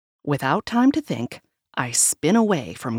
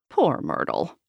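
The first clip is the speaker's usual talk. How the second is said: plain reading voice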